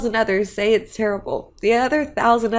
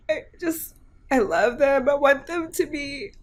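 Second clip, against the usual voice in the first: ordinary delivery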